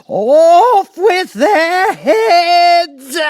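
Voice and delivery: very high, loud, drawn-out shriek; Queen's scream. A narrator's reading. The Queen condemning the gardeners, a long shrieked order. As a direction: scream the sentence as a tyrant.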